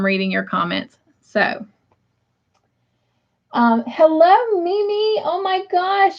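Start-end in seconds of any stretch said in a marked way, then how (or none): 3.9-6.2 light up at seeing her name; thrilled to see Mimi in the chat; delighted greeting. Real, not acted. Greeting a friend she missed.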